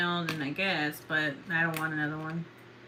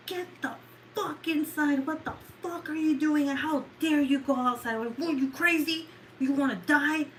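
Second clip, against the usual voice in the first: higher-pitched scolding, replayed from memory; retold yelling at the dog; 8 semitones higher than usual. Owner reenacting yelling at Evie the dog, higher and scolding. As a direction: bawl the dog out all over again, half in jest. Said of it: "I was yelling at her like she was a fucking human".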